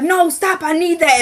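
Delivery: desperately grab it back; frantic plea. Retold or imitated. Wyatt wrestling for the drugs.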